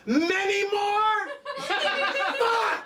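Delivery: very high, stretched incredulous cry; horrified disbelief. Acted. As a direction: shriek the question, then curse high.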